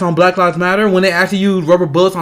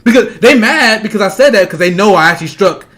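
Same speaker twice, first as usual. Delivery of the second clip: normal talking voice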